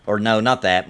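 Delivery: normal talking voice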